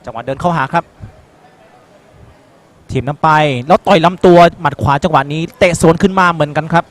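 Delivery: usual speaking voice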